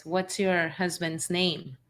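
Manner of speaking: ordinary talk, nothing marked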